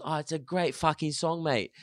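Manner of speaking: light English accent impression; mild English accent. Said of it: "Good accent".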